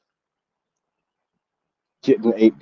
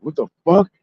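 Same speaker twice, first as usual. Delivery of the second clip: high, indignant what the fuck; retold angry outburst at the clerk; 9 semitones higher than usual. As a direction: snap it out in disbelief. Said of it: "I lost it on the person".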